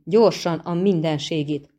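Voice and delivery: plain reading voice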